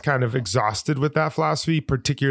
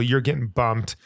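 ordinary talk

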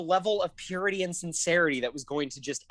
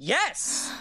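high-pitched, indignant retort; accusatory; 8 semitones higher than usual. Retold or imitated. Guest retelling the answer to a roommate asking about crying, accusatory. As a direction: throw it back as an accusation.

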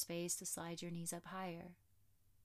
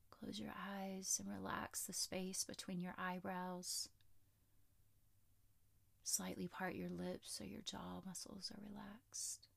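soft spa whisper; 4 dB quieter than usual. Acted. Guiding relaxation in shavasana.

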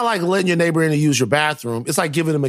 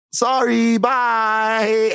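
higher, drawn-out dismissive goodbye; retold polite brush-off, stretching both words; sing-song brush-off; 8 semitones higher, much slower than usual.

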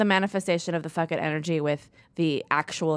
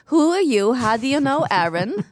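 higher, louder Minnesota aunt; nosy aunt quizzing a stranger; lightly voiced Minnesota aunt grilling a guest; 5 semitones higher, 4 dB louder than usual. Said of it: "I always do this voice".

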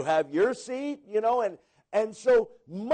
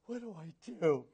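quiet, laughing mock dismay; half-laughing panic; 10 dB quieter, faster than usual. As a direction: ask it softly through a laugh, half joking. Panicked new missionary, first take.

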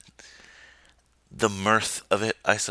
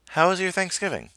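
sluggish, stuffed-full voice; food coma voice; 12 semitones higher than usual. Real, not acted. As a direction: talk like you just ate too much. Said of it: "recovering from a food coma voice".

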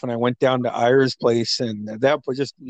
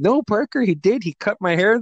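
lightly imitated friend insisting, pitched up; playful insistence; 7 semitones higher than usual. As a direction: insist to a kid in a higher retold voice.

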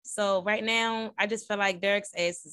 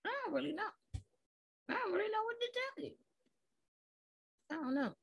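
very soft, high little voice; sheepish, barely-there admission; tiny sheepish voice; 11 semitones higher, 13 dB quieter, much faster than usual. Real, not acted. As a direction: shrink to a small, high, quiet voice. Sheepish aside about own attraction.

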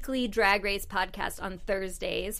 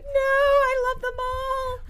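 much higher, slow gushing voice; high squeaky self-quote; slower than usual. Retold or imitated. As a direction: squeal it out slowly, smitten. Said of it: "and I'm like".

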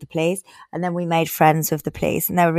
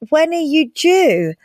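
put-on Irish accent; 8 semitones higher than usual. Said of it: "He repeated".

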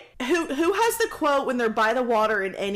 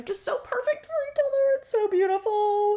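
much higher gushing swoon; 10 semitones higher than usual. Real, not acted. Fan gushing about the perfect couple, high. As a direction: gush like your heart melts.

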